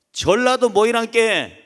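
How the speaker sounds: pushy Jeolla dialect, rallying the group; Jeolla accent. Acted. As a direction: imitate a Jeolla church elder calling people over. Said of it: "그러면서 전라도".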